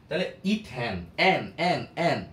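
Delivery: মিথেন with the ending এন repeated, punched; ending drilled. Shown as a demonstration. Teacher shows students the pronunciation, punching the ending. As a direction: drill the sound এন again and again. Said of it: "নামের শেষে উচ্চারণটা কি হইতেছে".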